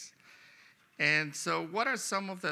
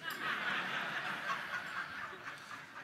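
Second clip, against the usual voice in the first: breathy laugh; 4 dB quieter than usual. Real, not acted. Speaker laughs at his joke.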